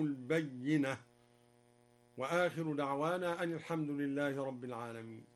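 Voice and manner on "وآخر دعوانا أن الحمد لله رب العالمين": normal talking voice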